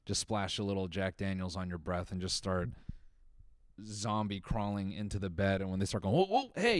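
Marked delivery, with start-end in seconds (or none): 6.1-6.8 high alarmed whoa whoa hey; startled whoa. Pretend. Roommate startled by a drunk in bed, high alarmed whoa. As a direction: jump in pitch like you were just woken.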